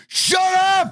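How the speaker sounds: high angry yell; shouting. Pretend. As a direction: bark it at them. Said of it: "I would come out".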